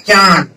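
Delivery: चांद with the आं nasalised through nose and mouth; nasal demo. Shown as a demonstration. Teacher asks students to try the word, nasalised चांद. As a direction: let चांद ring in nose and mouth together. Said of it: "आप एक शब्द बोल के देख लीजिए".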